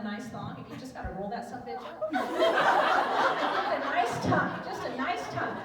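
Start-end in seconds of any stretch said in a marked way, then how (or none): 2.1-5.5 laughing. Acted. Joking about tucking, laughing.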